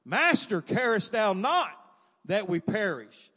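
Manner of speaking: loud, high, drawn-out plea shouted in fear; acted panic, slow and full-voiced; fearful desperate cry. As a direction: shout it like a drowning man begging for help. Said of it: "they had some fear in their voice".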